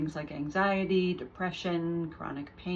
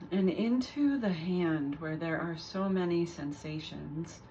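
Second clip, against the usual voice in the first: calm guided meditation with long pauses between phrases; guided body scan; 4 dB quieter than usual. Real, not acted. Teacher guiding listeners through a mindful body scan, unhurried meditation voice. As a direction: lead them gently through the body, leaving space after each phrase.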